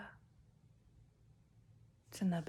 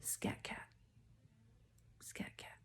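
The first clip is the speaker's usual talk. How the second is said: psychic whisper; 14 dB quieter, slower than usual. Acted. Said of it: "just got a download".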